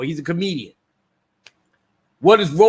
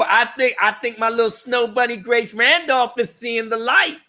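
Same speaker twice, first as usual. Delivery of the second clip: normal talking voice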